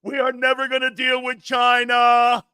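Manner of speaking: loud, high, shouting mock Trump with a changed timbre; hammed-up presidential shout; Trump impression. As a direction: yell it like a president at a rally. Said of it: "Mister President".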